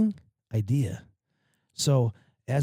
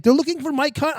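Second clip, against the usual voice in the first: much higher, innocent mom voice; oblivious mom; 13 semitones higher, 4 dB louder than usual. Retold or imitated. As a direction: explain it brightly, clueless. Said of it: "She's like".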